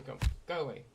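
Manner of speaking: high, hammed-up wizard banishing; mock banishing. To the object blocking his build, hammy sorcerer banishing. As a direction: shoo it off like a pompous sorcerer. Shooing the in-game object mockingly.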